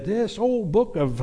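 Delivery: high, drawn-out old radio preacher impression; slow down and pitch up, imitating a Bible teacher; imitated preacher's drawl, pitched high; J Vernon McGee. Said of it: "I can hear him saying it".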